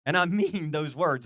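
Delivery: a pastor's slow earnest vow to the congregation; sincere. Real, not acted. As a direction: lean in and mean every word.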